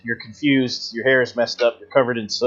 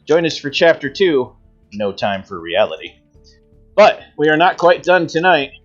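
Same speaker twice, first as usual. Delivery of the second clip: announcer voice; 3 semitones higher than usual. Acted. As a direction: address the audience like a host. GM's closing announcement to viewers.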